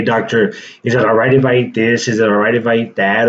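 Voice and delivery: ordinary talk, nothing marked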